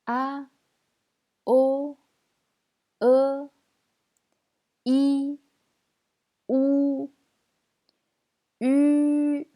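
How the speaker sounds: "A, O, E, I, O, U" each sustained high and level; loud, high, separate pure vowels "A, O, E, I, O, U"; chant-like level delivery of "A, O, E, I, O, U", pauses between; vowel drill. Shown as a demonstration. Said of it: "on va voir les finales simples".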